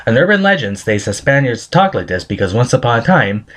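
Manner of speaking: ordinary delivery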